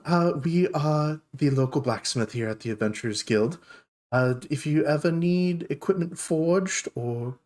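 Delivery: high opening hello, then lilting sales patter; polite dwarf shopkeeper. Acted. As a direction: greet the newcomers politely in character.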